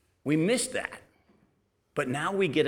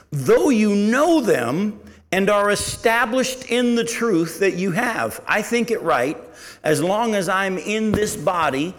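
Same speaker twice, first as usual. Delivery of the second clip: scripture reading; 3 semitones higher, 3 dB louder than usual. Acted. Reads scripture aloud.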